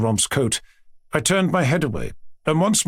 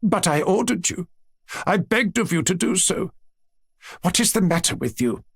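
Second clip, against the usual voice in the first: higher-pitched pressing appeal; insistent, concerned questioning; insistent grandmother; 6 semitones higher than usual. A narrator's reading. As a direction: press the child, moving from order to plea. Grandmother insists, then questions boy.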